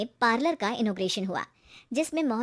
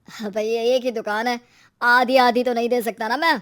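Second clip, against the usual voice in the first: male shopkeeper refusing, louder at the end; businesslike owner growing more emphatic; shop owner, dismissive; 8 dB louder than usual. Acted. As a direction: brush the customer off like a busy landlord.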